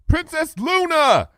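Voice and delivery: high, slow call of Twilight's name; high pony voice calling out; shouted call. Acted. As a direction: call out to the princess urgently. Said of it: "I shouted".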